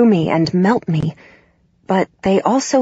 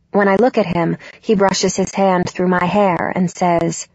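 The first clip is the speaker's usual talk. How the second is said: usual speaking voice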